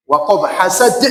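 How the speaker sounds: WAQAB HASAD, final letters stopped dead; deadened qalqalah. Shown as a demonstration. Teacher shows forbidden reading, Waqab hasad cut short. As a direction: choke off the echo after b and d in Waqab hasad. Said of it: "Nggak boleh".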